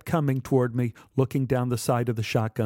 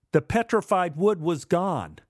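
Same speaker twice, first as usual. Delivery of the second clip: ordinary delivery, nothing marked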